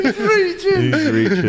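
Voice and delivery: high, helpless laughing tease; words squeezed out high while cracking up. Real, not acted. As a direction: say it through a big laugh. Cracking up at co-host's argument.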